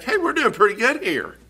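high, breezy self-satisfied voice. Retold or imitated. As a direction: pat yourself on the back, lightly mocking. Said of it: "and think".

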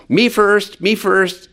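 put-on high childish whine of me first; mimicking a child. Preacher imitating selfish schoolchildren, high and childish. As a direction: squeal it like a pushy kid. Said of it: "when they're in grade school".

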